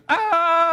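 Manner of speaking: high short imitated shriek; brief high imitated cry; mock yelp. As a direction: yelp like the running man. Imitating man running around.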